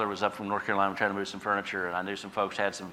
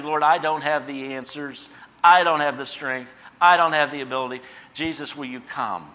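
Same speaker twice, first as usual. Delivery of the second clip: louder, higher pleading prayer; confessing prayer; 5 semitones higher, 7 dB louder than usual. Pretend. Believer confessing weakness to the Lord, loud pleading prayer. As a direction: cry out to the Lord. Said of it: "just confess freely".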